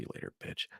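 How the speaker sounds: whispered sneering goodbye; mocking whisper. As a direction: breathe the farewell taunt under your voice. Parting taunt to the doll.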